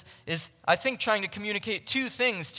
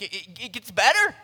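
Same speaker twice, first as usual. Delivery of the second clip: acted excitement; 3 dB louder, faster than usual. Voicing Paul's delight at more grace.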